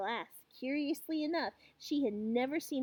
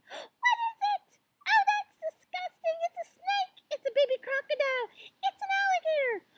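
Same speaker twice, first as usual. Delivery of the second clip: high, loud squeals of alarm; excited shouting; 9 dB louder than usual. A narrator's reading. Children squirm at the creature in the glass, shouting. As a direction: shriek like a roomful of startled children.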